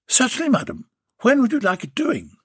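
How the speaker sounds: high, chirpy tradesman; cheeky workman taking a booking; cheery tradesman on the phone; Warren's voice. Acted.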